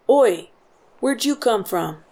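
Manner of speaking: loud guard's hail; calling out. A narrator's reading. Soldier challenging a newcomer at the gate, loud call. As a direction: shout across the road to a stranger. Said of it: "called out to me".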